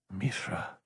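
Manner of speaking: low, very soft mutter. A narrator's reading. Logan to himself on hearing the message, muttered. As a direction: mutter it under the breath. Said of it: "Logan muttered".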